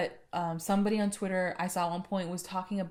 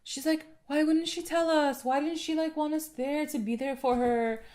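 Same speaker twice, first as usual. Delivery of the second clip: higher-pitched hurt mom voice; imitating a worried mom; 7 semitones higher than usual. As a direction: play the upset mother asking why. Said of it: "Alice is like".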